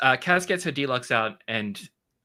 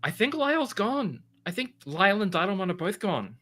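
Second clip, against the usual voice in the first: worried; 8 semitones higher than usual. Acted. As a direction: break the bad news. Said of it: "and is like".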